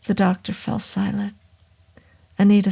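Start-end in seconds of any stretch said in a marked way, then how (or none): none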